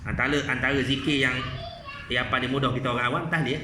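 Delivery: normal talking voice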